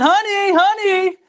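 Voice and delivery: high, loud retold holler; calling out. Older gentleman calling his wife to the door, retold holler.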